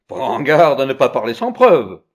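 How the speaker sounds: loud, stern warning; furious, forceful threat; furious judge. A narrator's reading. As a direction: warn forcefully, in anger. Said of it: "répondit le juge furieux".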